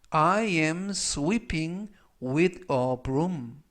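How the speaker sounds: very slow, word-by-word English model; slow, deliberate teaching read; language-lesson model reading. Shown as a demonstration. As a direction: read it out slowly for learners to repeat.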